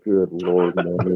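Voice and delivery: low, resigned sigh of words; quietly exasperated aside; retold exasperation. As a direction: drop low and soft in weary disbelief. Retold weary reaction to Manny.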